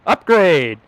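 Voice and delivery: loud, high excited callout; upgrade shout. Real, not acted. Streamer celebrating the hat upgrade, loud excited shout. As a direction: cheer the upgrade like a hype announcer.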